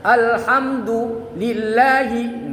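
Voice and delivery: "Alhamdulillahi" drawn out then halted; wrong pause demo; broken-off recitation. Shown as a demonstration. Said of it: "nggak boleh".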